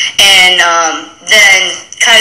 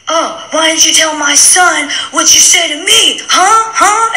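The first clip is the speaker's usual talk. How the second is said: high, needling mock voice, the huhs pitched way up; mocking Nathan's mom; 9 semitones higher than usual. Imitating Nathan's mom taunting David, in a high needling voice. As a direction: goad him with a shrill taunting huh.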